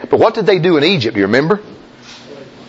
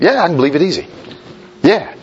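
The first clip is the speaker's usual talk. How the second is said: ordinary talk, nothing marked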